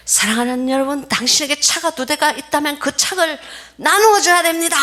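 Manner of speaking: high, pressing preacher voice; fervent preaching. Retold or imitated. Missionary preaching to villagers about sharing bread, high fervent preaching. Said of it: "맨 끝에 이제 이 사람이".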